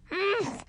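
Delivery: high squashed grunt from under someone; muffled oof. Acted. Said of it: "said Lil in a muffled voice".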